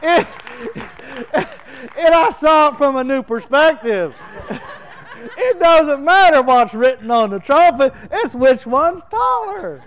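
high, loud, laughing through the words; can barely get it out for laughing. Real, not acted. Preacher cracking up at his son's wisdom, laughing.

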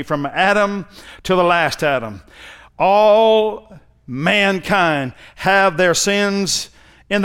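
high, loud, building preacher cadence; full-force preaching. Acted. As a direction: preach it with rising intensity.

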